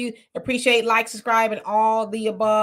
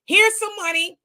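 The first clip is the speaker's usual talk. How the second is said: much louder, higher mock-generous call; pretend cheerful offer; 9 semitones higher, 9 dB louder than usual. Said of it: "they would say".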